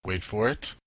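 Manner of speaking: usual speaking voice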